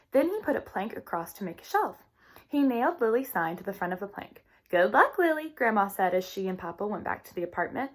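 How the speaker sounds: lively read-aloud storytelling voice; reading to children. A narrator's reading. Teacher reading a storybook to her class, lively. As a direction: read the chapter brightly to young listeners.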